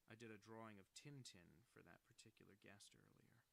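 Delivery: ordinary talk, nothing marked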